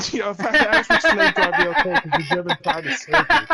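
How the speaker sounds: loud, fast, laughing through the words; cracking up mid-sentence. Real, not acted.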